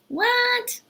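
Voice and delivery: very high, puzzled yelp; mock confusion. Teacher reacting to a made-up expression, mock confusion. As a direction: act baffled, pitch shooting up.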